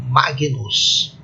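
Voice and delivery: Magnus with hard g, then n; "Magnus" demonstrated with g and n apart; reconstructed Latin pronunciation. Shown as a demonstration. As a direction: say "Magnus" as MAH-gee-noos. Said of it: "é a pronúncia é assim".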